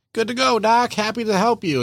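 high, breezy mock cheer; cheerful helper. Mechanic friend fixes a tire, breezy high cheer. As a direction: sound like it was no trouble at all.

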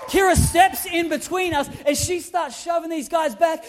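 usual speaking voice

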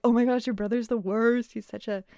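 lightly acted gossipy rant; imitating an indignant complaint; mock rant. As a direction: rant about him, playfully exaggerated. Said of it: "goes on this rant".

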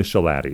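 "shalati" as shuh-LAT-ee; correct surname pronunciation. Shown as a demonstration. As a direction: say "shalati" rhyming with daddy. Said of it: "it does rhyme with daddy".

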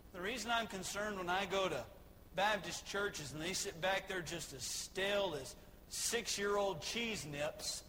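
normal talking voice

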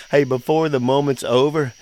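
ordinary delivery

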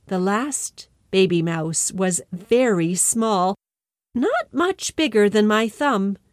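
usual speaking voice

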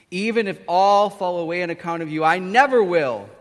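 loud, emphatic promise; passionate vow. Acted. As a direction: swear loyalty forcefully. Passionate Peter vows loyalty.

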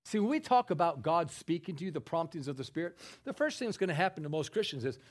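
usual speaking voice

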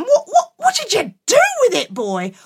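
high, loud outraged mother; outraged mum. Acted. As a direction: splutter in outrage.

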